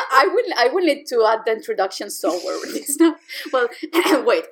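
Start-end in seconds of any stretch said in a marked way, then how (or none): none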